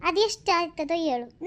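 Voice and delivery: ordinary talk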